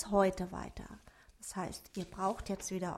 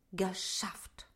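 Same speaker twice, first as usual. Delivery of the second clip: breathy whispered relief; relieved whisper; 5 dB quieter than usual. A narrator's reading. Little dwarf whispers his relief. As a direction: whisper it, glad it's done.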